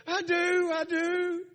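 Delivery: high, loud, emphatic joy; overjoyed. Retold or imitated. New believer affirms Jesus in her heart, overjoyed. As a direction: imitate a thrilled new believer.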